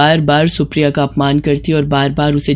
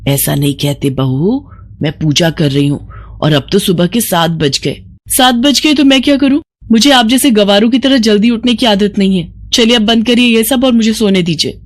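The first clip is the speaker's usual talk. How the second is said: raised, rude and irritated; angry daughter-in-law; 4 semitones higher than usual. Acted. Woken bride scolding her mother-in-law, angry. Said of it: "वो गुस्से में बाहर आई".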